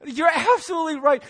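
real laughter breaking through the words; laughing. Real, not acted. Pastor recalling the missionary's joke, laughing. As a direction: laugh out loud while you talk. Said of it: "I just started laughing".

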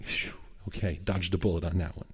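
low, hushed sigh of relief; relieved aside. Retold or imitated. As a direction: let out a phew and murmur to yourself. Father privately relieved after hard question.

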